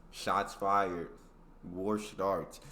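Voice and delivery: usual speaking voice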